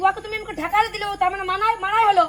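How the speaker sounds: angry scolding. Acted. Mother-in-law rebuking daughter-in-law over the child, angry. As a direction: rebuke with rising force.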